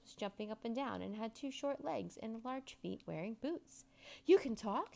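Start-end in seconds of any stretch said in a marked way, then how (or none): 4.2-4.9 louder, astonished question; amazed. A narrator's reading. Boy amazed that the signpost talks, astonished. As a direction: blurt it out in disbelief.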